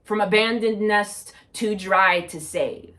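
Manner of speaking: projected, measured spoken-word delivery; poetry reading. Acted. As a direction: recite it like a poem to an audience. Reads own poem aloud.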